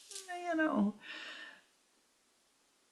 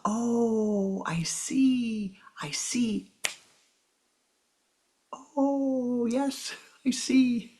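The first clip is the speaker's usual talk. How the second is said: high, slow wondering "oh, I see"; dawning realization; 7 semitones higher, much slower than usual. Retold or imitated. Spiritual teacher voicing a student's aha, slow wonder. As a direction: let the realization dawn slowly.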